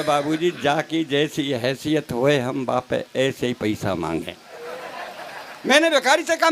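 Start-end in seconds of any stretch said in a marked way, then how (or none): 0.0-4.4 low, slow humble beggar's plea; pleading beggar. Acted. Beggar with folded hands pleading with the poet, low and slow.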